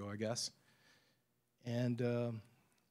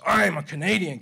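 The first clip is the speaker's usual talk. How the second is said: much louder, firm and declarative; firm Clint Eastwood; 4 semitones higher, 12 dB louder than usual. Shown as a demonstration. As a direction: stand your ground and say it hard. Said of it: "that's the way you have to answer those guys".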